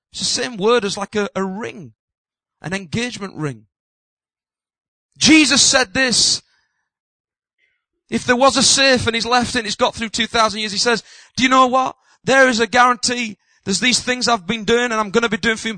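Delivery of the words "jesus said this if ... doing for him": louder, higher proclamation; full-force preaching. Acted. Preacher proclaiming Jesus' guarantee, full-force preaching. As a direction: proclaim it to the back row.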